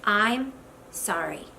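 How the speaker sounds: two slow, separated words; slow, subdued, understated sorry; husband's minimal apology. Acted. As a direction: imitate a terse husband's apology, slow and low. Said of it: "I'll tell it to you in two words".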